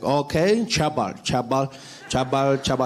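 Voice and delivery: "Chabal" for Trevor, ch for tr; guard mispronouncing Trevor. Acted. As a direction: slowly try out "Chabal" as a botched Trevor. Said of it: "the security guard came out".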